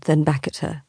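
usual speaking voice

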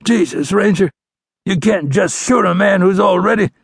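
high, strained outlaw voice; protesting wounded outlaw. A narrator's reading. Wounded outlaw begging the ranger not to shoot, pained protest. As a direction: protest through the pain.

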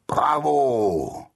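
lauter Bravo-Jubel; jubelnder Ruf. Acted. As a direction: feier den Helden laut. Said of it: "und alle Hasen riefen". (alle Hasen jubeln dem mutigen Angsthasen zu)

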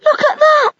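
high, loud astonished shout; excited shout. Acted. Soren shouting to his family at the hatching. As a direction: shout it out in wonder.

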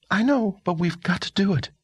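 put-on high, startled girl's voice; stunned young woman. Girl character voice.

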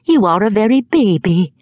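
put-on imperious old lady, a touch amazed; Miss Betsy. A narrator's reading. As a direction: exclaim in disbelief. Betsy remarking on the mother.

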